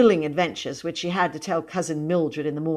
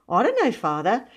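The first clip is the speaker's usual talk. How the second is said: high, light guileless reply; bright innocence; 7 semitones higher than usual. A narrator's reading. Boy explaining himself to his father, brightly innocent. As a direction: pipe up as if blameless. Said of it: "spoke up brightly and innocently".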